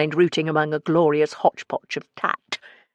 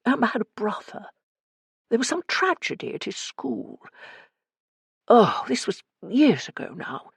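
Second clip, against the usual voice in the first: higher-pitched voice, remembering hesitantly; Morris, recalling old gossip; 5 semitones higher than usual. A narrator's reading. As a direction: voice a man piecing together an old tragedy, pitched up. Narrator voices Morris in dialogue.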